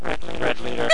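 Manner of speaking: distorted robotic mic voice; garbled robot-sounding radio voice. Real, not acted. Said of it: "The robot voice is horrible".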